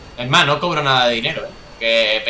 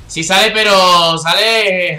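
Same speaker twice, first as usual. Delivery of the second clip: slow, loud and higher; drawn-out gleeful; 4 semitones higher, 6 dB louder, much slower than usual. Real, not acted. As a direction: stretch it out with glee.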